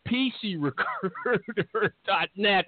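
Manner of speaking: slow announcer flourish, stretching "recruiter"; drawn-out web address. Acted. Host repeating sponsor's web address, drawn-out flourish. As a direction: linger playfully on "recruiter", rolling it out.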